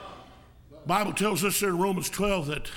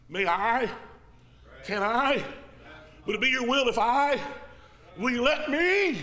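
building plea; 5 semitones higher than usual. Retold or imitated. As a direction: plead more and more urgently. Peter's plea retold.

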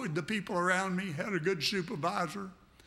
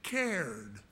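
slow, weighted reading; 2 semitones higher, much slower than usual. Shown as a demonstration. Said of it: "But look at there".

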